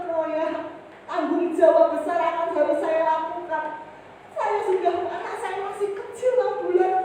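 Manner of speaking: high, strained plea as if near tears; tearful pleading prayer. Retold or imitated. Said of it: "Saya nangis di hadapan Tuhan".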